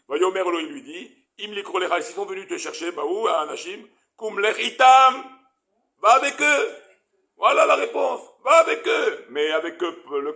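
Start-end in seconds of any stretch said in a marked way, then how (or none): 4.2-9.3 emphatic exclamation. Shown as a demonstration. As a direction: call out God's answer with force. Teacher exclaims God's answer. (proclamation forte et aiguë)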